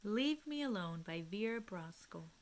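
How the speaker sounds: ordinary talk, nothing marked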